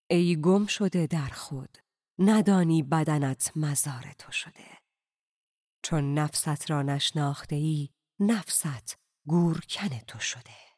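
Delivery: slow, measured poem reading; solemn slow recital of a Persian poem. A narrator's reading. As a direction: read the verse slowly, savouring each line. Poem read within narration.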